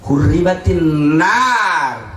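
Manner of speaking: slow chanted Arabic; slow solemn Arabic chant; slow recitation. Real, not acted. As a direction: intone the hadith slowly.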